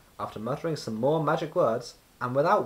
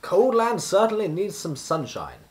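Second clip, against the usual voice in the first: wizard voice; 7 semitones higher, 7 dB louder than usual. A narrator's reading.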